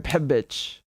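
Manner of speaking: a light Iraqi accent demo; Iraqi ch sound. Shown as a demonstration. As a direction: show the Iraqi ch in "Pebich". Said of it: "they use the ch a lot".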